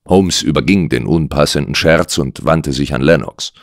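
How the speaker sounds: plain reading voice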